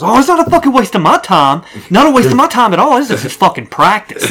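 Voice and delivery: high-pitched mock indignation; imitated angry gamer. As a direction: imitate a rattled opponent protesting. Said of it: "he starts getting so angry".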